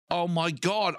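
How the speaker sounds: high-pitched retold awe; amazed child. Comic reliving seeing the dwarfs come out, amazed. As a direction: gasp it like a starstruck kid.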